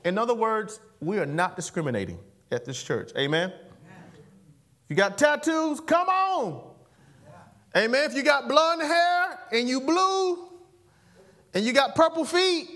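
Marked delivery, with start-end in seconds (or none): 4.9-12.7 high, loud playful preacher tease; teasing. Acted. Preacher jokingly inviting tattooed people to church, playful. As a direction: tease the crowd with exaggerated welcome.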